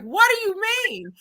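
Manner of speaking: high, incredulous question; retold disbelief. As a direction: act out being completely floored. Retelling her floored reaction.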